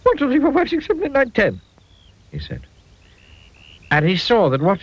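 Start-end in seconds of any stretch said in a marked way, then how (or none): 0.0-1.6 high squeaky counting. A narrator's reading. Counting to calm himself.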